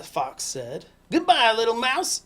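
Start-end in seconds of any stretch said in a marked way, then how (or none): 1.1-2.2 loud, high fox farewell; fleeing fox. Acted. Fox hurries away from mouse, calling out loudly. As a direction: call goodbye high and loud.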